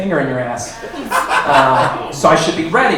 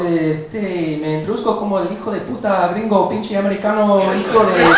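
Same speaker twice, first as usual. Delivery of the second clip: higher, hammed-up Spanish stand-up persona; alter ego Nicolas; 5 semitones higher than usual. Comic in his alter ego greets the crowd as Nicolas. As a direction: play the cocky gringo comic Nicolas. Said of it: "doing a little bit of stand-up for you as Nicolas".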